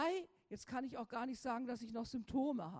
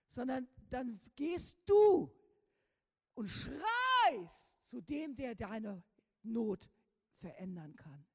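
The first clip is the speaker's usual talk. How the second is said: urgent preaching; 6 dB louder, slower than usual. Real, not acted. Preacher urging cry to God.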